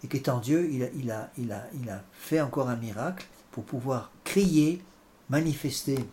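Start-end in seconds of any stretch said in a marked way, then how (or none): none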